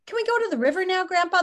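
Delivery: a hint of an excited little boy asking; child's voice. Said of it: "the boy asks".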